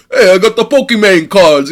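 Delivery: put-on hammy North Jersey Italian mobster accent. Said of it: "heavy North Jersey accent Italian guys going".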